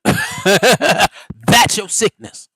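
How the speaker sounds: loud laughing jab; mocking loud laugh; laughing scold. Real, not acted. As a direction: laugh it off while calling it out. Laughing rebuke of a claim.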